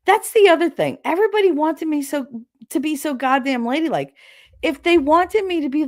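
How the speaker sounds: ordinary talk, nothing marked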